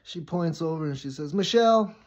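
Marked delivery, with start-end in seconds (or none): 1.3-2.0 high, loud call across the room. Acted. Teacher calling on a student across class, calling out. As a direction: call out the name sharply, pitched up.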